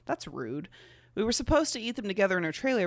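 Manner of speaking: usual speaking voice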